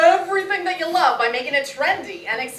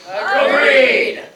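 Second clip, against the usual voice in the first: loud agreement; 3 semitones lower, 6 dB louder than usual. Acted. All agree with a cheer.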